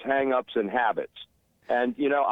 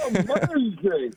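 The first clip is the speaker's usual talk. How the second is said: excited; 6 semitones higher, much slower than usual. Real, not acted. Caller's excited greeting.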